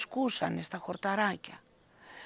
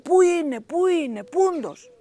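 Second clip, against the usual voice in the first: higher, louder calling; shout, searching for the wolf; villagers shouting; 8 semitones higher, 7 dB louder than usual. A narrator's reading. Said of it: "και φώναζαν".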